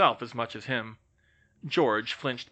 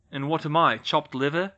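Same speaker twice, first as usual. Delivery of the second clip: demanded; 4 semitones higher, 5 dB louder than usual. Acted. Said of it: "George demanded".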